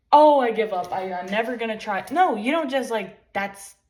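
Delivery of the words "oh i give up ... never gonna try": loud, exaggerated exasperated whine of defeat; mock giving up. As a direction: throw up your hands in mock despair. Mimicking someone who quits at problems.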